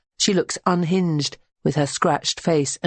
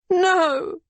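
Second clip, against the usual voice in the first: wail. A narrator's reading. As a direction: wail out in grief. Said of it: "Berenice wails".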